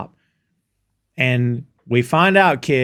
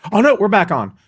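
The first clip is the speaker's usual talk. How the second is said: brightly higher-pitched retold relief; happy; 7 semitones higher, 4 dB louder than usual. Friend retells Beard's make-up news with Jane, happy. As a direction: quote him perking up happily. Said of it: "he's, like, happy".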